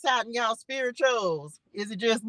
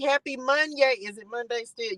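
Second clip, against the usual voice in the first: ordinary talk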